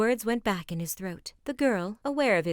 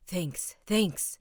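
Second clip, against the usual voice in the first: usual speaking voice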